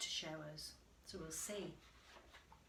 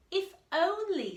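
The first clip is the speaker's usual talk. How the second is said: a touch higher, louder little mouse; hopeful mouse; 9 semitones higher, 12 dB louder than usual. Acted. The mouse wishing to be like the lion, lightly voiced. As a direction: wish it out as the small mouse. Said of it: "thought Mouse".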